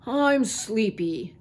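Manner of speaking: slow, drawn-out grumble; groggy, complaining character voice; sleepy complaint. A narrator's reading. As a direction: drag the words out like a sleepy old uncle. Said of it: "complained Uncle Phil".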